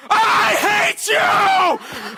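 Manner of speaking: long, high, drawn-out scream; acting out mom's screaming from upstairs; mother screaming in rage. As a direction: stretch it into a furious shriek. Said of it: "and she goes like this".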